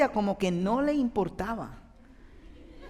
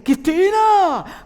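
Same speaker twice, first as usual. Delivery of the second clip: much louder, high, drawn-out shout of a name; re-enacted angry yell; 11 semitones higher, 11 dB louder, much slower than usual. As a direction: yell the wife's name as in a heated argument. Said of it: "yo estoy gritando".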